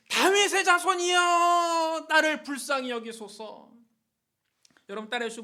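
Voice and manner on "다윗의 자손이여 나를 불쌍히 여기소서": very loud, high, long-held plea; even louder cry. Acted. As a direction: belt out a slow, desperate cry for mercy. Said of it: "더 크게 소리 질렀습니다". (목청껏 더 크게 부르짖기)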